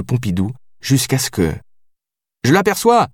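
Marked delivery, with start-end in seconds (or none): 2.4-3.1 high-pitched cry of sighting; calling out. A narrator's reading. Lieutenant spotting the man through binoculars, calling out. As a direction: call it out to the crew. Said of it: "cria Capella derrière ses jumelles".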